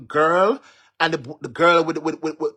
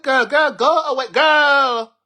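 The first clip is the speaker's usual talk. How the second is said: high, loud shoo-away yell; loud, high-pitched dismissal; dismissive shout; 11 semitones higher, 8 dB louder, slower than usual. Acted. Acts out her dismissal of them.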